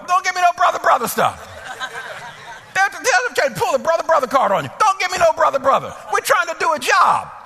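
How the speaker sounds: high, loud, indignant rant; hammed-up scolding. Supervisor scolding staff for pulling the brother card, hammed-up outrage. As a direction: let each line burst out high, then fall. Acted scolding of the idle employee.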